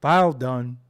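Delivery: low, flat robotic announcement; AOL computer voice. Retold or imitated. Streamer teasing a dial-up viewer, low robotic computer voice. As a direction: say it like an old modem chime voice.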